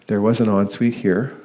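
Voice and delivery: normal talking voice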